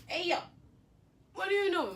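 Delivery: high, loud, baffled challenge; incredulous call-out. Retold or imitated. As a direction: call him out in high-pitched disbelief. Retelling her reaction to his outburst.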